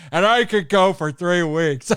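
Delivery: slow, unhurried dragon line; a lightly voiced dragon, taking his time; a hint of Draco's drawn-out boast; imitating Draco. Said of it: "and then Draco says".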